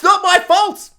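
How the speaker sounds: old lady denying blame in a hammed-up shout; mock outburst. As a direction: protest your innocence too hard. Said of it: "she's all like".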